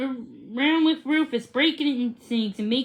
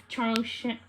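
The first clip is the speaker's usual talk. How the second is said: ordinary delivery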